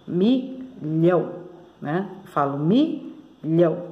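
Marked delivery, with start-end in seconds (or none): 0.1-1.3 MILHÃO slowly in two separate syllables. Shown as a demonstration. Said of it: "tem duas sílabas a palavra". 2.6-3.8 MILHÃO slowly broken in two; in two pieces. Shown as a demonstration. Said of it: "dois pedacinhos".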